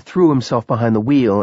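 usual speaking voice

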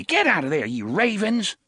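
high, loud scolding woman; sharp call. Acted. Woman catching the boys, sharp loud call. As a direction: snap at the boys from afar. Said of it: "called sharply".

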